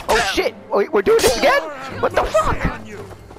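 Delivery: very high startled yelps; shocked shriek. Real, not acted. Gamer shocked the fight starts again, shrieking. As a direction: squeal in disbelief.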